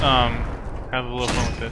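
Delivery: normal talking voice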